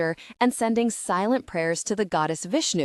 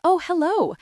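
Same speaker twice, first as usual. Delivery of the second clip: bright, much higher, charming hello; 10 semitones higher, 4 dB louder than usual. A narrator's reading. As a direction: turn on the charm. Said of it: "trying to sound as charming as I can".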